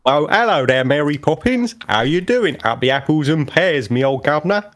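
playful mock cockney greeting. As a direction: ham up a bad movie cockney accent. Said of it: "a good Dick Van Dyking".

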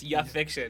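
"ya fiction", YA as one syllable "ya"; YA said as a word. Retold or imitated. Teasing the co-host's misreading, "ya" as a word. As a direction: say "ya" instead of the letters Y-A. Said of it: "No one calls it young adult fiction".

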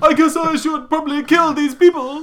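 a hammed-up killer plotting aloud; mock villain voice. Comedians riffing, villain musing about killing. Podcast joke, voicing killer.

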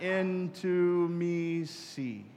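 slow, spaced-out into me see. Shown as a demonstration. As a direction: stretch into me see out, very slow, word by word. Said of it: "kinda slow down as you say it".

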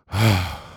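a heavy, fed-up sigh; exasperated sigh. Retold or imitated. Imitating the impatient lady, a heavy exasperated sigh. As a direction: let out a long annoyed sigh. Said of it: "this lady was huffing and puffing".

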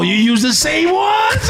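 slow high disbelieving shout; can't believe what he hears; shocked. Real, not acted. Friend hears they share razors, shouts in shock. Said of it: "shocked question".

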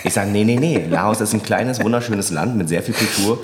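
ordinary talk

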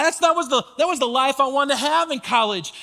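ordinary talk, nothing marked

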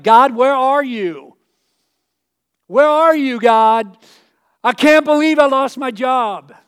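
loud, slow anguished plea; desperate cry to God. Acted. Believer who lost his job cries out loudly to God. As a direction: call out to God in despair.

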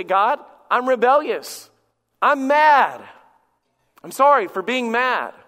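loud, drawn-out admission to God; acted confession. Teen praying alone in the bedroom, loud acted confession. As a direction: own up forcefully, word by word. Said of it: "you get before God, and you say".

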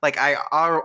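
usual speaking voice

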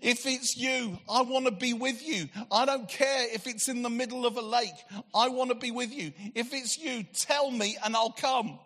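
high, passionate rush of devotion; acting out breathless eagerness to reach someone beloved; excited, impulsive. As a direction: blurt it out before thinking it through. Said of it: "He was so excited".

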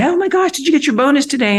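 a husband bursting to hear the news; lightly voiced excited husband; eager husband.

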